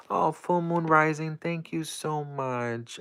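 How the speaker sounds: high-pitched, drawn-out grateful gush; gushing thanks. Real, not acted. Streamer thanking a viewer on the live, gushing. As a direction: gush with surprised gratitude, stretching the thanks.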